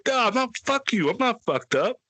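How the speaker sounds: put-on high-pitched, indignant drunk protest; mock mad denial. Drinker accused by friends, imitating his own mad denial. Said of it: "And then I'll get mad".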